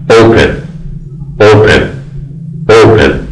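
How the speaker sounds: اوپن with the weight on the first syllable O; stress on first syllable. Shown as a demonstration. Teacher to students: open, first syllable stressed. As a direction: hit the O of اوپن, let pen fall away. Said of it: "سیلاب اوله که او باشه این فشار آوردم".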